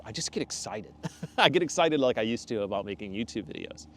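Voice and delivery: ordinary talk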